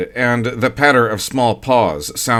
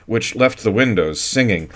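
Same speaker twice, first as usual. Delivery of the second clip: ordinary delivery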